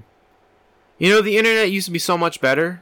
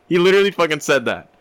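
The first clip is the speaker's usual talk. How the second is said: ordinary talk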